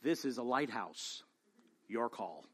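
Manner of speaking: low, soft and unbothered; calm lighthouse keeper, utterly unfazed. Retold or imitated. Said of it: "the reply on the other end is very calm".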